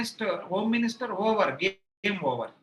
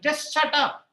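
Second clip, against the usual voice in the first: angry retort; 5 semitones higher, 5 dB louder than usual. Retold or imitated. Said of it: "He said".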